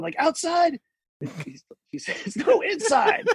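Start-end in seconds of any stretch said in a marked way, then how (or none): 0.2-0.8 mock yell. Customs guy yelling back at the sergeant, high mock yell. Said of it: "The guy's, like, yelling". 2.5-3.3 laughing. Retold or imitated. Sergeant's reply, laughing through it.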